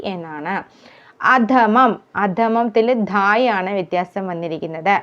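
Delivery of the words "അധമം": normal talking voice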